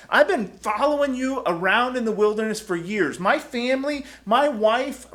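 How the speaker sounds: a disciple listing all he gave up; loud heartfelt protest to Jesus; Peter's earnest plea. Retold or imitated. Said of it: "Peter rightfully says".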